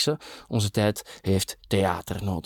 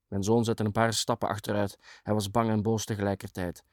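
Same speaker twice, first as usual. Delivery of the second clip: plain reading voice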